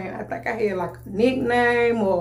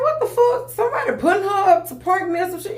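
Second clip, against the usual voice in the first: much higher, fast, indignant disbelief; caught an attitude; 11 semitones higher, 3 dB louder, faster than usual. Retold or imitated. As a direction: fire it off, outraged and incredulous. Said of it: "I kinda caught an attitude".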